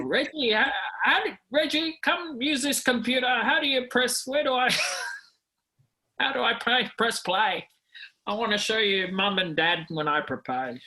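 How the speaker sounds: put-on high, fumbling old man asking for help; confused old dad stuck on the computer; high quavery elderly voice; doddery old man. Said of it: "Am I gonna talk like that?".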